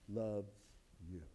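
softly landed loves you; hushed emphasis. Real, not acted. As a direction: let loves you drop low and tender. Dramatic lowered delivery.

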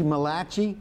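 Malachi said muh-LAH-chee, Jersey Italian style; Malachi as muh-LAH-chee with a Jersey twist; Jersey accent. Shown as a demonstration. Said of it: "or as they'd say in Jersey".

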